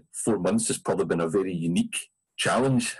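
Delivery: ordinary talk, nothing marked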